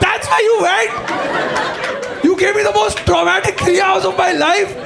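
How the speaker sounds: a son yelling at his parents over the lost night, outraged shout. Retold or imitated. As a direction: blast it out, outraged. Kid outraged at parents, retold.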